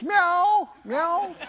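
high mewing cat imitation; playful high-pitched kitty meows. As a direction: meow like a pleading stray cat. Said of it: "and goes".

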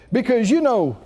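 usual speaking voice

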